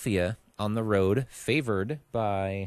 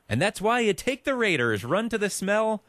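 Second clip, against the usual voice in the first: excited; 10 semitones higher, 4 dB louder than usual. Real, not acted. Bold upset pick, hyped.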